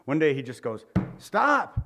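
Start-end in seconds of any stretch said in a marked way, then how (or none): 1.2-1.8 sudden loud, high-pitched "stop"; exasperated outburst. Retold or imitated. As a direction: cut them off with a sharp, raised shout. Fed-up priest interrupts repeated claims.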